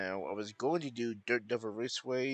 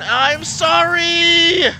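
high, drawn-out screamed apology; yelled apology; 18 dB louder, much slower than usual. Real, not acted. Racer apologising for a collision, yelled apology. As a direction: wail it loudly across the track. Hits another racer mid-talk.

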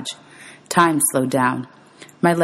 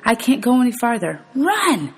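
much higher pleading cry; exhausted mother crying out for her son to run; desperate urging; 8 semitones higher than usual. Acted. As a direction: force the last of your breath into it. Exhausted mother urges son away.

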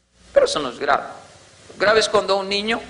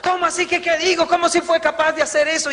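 chillido indignado de esposa, muy agudo; esposa indignada, aguda; 12 semitones higher than usual. Retold or imitated. (la esposa le reclama al marido, voz muy aguda)

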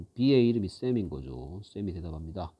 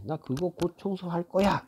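high character voice; 9 semitones higher than usual. Acted. Teacher voices Sam's reply.

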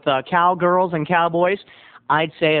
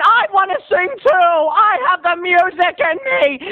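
high, hammed-up plea; insistent outburst; 13 semitones higher, 4 dB louder than usual. Storyteller butts into the fight, pleading loudly. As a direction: demand your turn, pitched way up.

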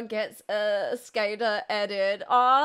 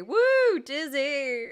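ordinary delivery

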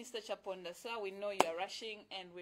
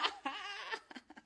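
high-pitched laugh; high burst of laughter; 13 semitones higher than usual. Real, not acted. Reacting to sugar daddy joke.

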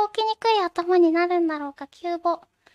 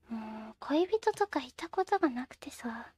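whisper; 5 semitones lower, 8 dB quieter than usual. Real, not acted. Confiding about no lover.

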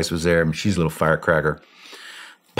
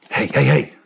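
raised, higher urgent call; 7 semitones higher than usual. Retold or imitated. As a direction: rouse someone with news. Someone waking him, calling out.

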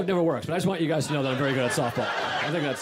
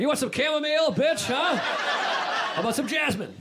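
high-pitched trash talk; hammed-up fight challenge, drawn out at the end; mock tough taunt; 11 semitones higher, slower than usual. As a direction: taunt like a silly tough guy. Fighter's taunt about tea, acted.

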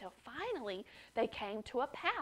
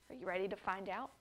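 ordinary delivery, nothing marked

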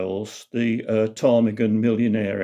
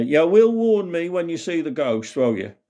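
raised, proper English stage delivery; 6 semitones higher than usual. Retold or imitated. Buttons asking the panto audience for warning, in proper English. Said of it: "he did it in proper English day".

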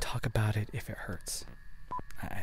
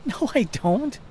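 high protest; 14 semitones higher, 7 dB louder, slower than usual. Acted. Arguing with himself.

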